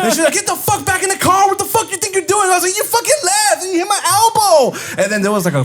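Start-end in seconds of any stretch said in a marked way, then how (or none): 0.3-2.5 very high mock screaming; retold scream. Girlfriend back with the car ordering him in, imitated high scream. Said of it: "She was screaming at me". 2.8-4.8 very high-pitched mock outrage; indignant yelling back. Boyfriend to girlfriend in the street quarrel, high indignant yelling.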